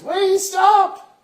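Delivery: very high, loud cry to halt; urgent shout. Acted. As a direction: yell out to stop someone mid-act. Boy interrupts the blessing urgently.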